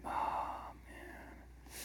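angry whisper under the breath; seething to yourself in a whisper; whispered fuming. Retold or imitated. Wife steaming mad at husband, whispering her fury. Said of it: "you're just sitting there steaming mad".

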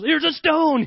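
loud high jokey shout; hammed-up father shouting; mock gruff dad. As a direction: toss the line like a joke dad hurling a stone. Father throws stone at son.

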